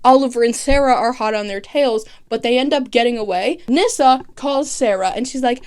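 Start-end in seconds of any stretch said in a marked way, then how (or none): none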